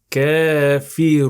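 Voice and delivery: كافرون with the vowel stretched long. Shown as a demonstration. Teacher demonstrates the long stretch for students. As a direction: draw out كافرون at the stop. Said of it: "So like this".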